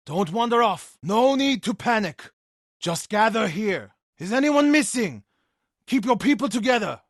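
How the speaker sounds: loud, high calls to gather the crowd; urgent rallying call to scattered villagers. Acted. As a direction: project across the village to herd everyone in. Monk rallying villagers before Mongol attack.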